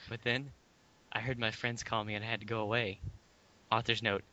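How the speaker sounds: ordinary talk, nothing marked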